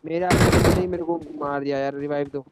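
high loud panicked plea for revive; panicking gamer. Real, not acted. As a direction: beg the team to save you. Knocked down, begging for revive.

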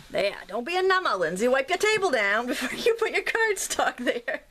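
put-on mild Maine accent, laughing at herself. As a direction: tease yourself in a Down East Maine voice. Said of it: "for that accent".

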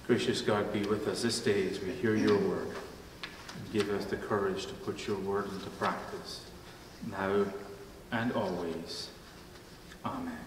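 soft, low prayerful voice, hushed. Acted. Pastor praying softly before the sermon, hushed and low. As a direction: pray quietly over the congregation.

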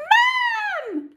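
high, loud scream. A narrator's reading. Peter screams for Mum after Henry's trick, high and loud. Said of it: "screamed Peter".

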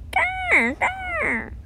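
high, squeaky creature grrs; sea creature growl. Acted. Sea creature answers in its own language, high growls. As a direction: play a little sea animal talking in growls. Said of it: "Your languages even sound dis-, di-, different".

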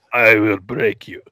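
low, heavy mock Russian menace; Russian villain, loud and mock-menacing; Russian accent. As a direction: threaten in a hammed-up Russian accent. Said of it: "he's like".

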